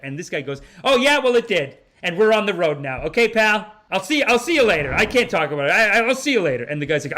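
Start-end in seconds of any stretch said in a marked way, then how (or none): 0.8-6.6 loud, high, rattled brush-off; hammed-up angry hang-up; mock furious sign-off. Guest cuts off the apologizing owner, loud mock anger.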